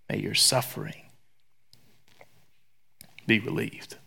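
soft, low blessing spoken gently; softly spoken benediction; tender blessing. Real, not acted.

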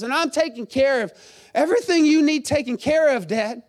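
loud, high, aggrieved son pleading to his father; resentful older son. Acted.

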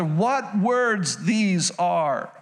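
very slow, marvelling delivery; slow amazed reading. Acted. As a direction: stretch each word out slowly in wonder.

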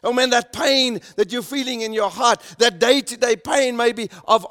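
preacher proclaiming hope to his hurting listeners, fervent and loud; preaching at full force. Acted. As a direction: build it to a ringing climax of hope. Sermon climax, building to full force.

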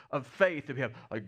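ordinary talk

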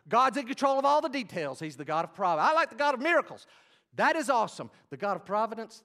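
high, loud pulpit proclamation; full-force preaching. Real, not acted. Preacher declaring God's providence to congregation, full-force preaching.

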